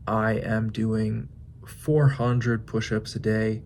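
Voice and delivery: normal talking voice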